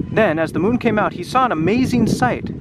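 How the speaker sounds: ordinary delivery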